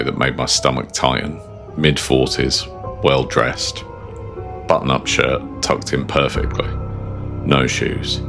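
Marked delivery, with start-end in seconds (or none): none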